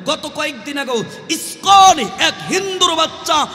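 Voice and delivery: high, loud outcry; indignant preaching. Acted. Preacher protesting insults to the Prophet, loud indignant cry.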